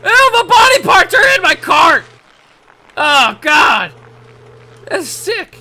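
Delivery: high, loud grossed-out yell; disgusted shout. Real, not acted. Gamer grossed out by the cart's cargo, disgusted shout.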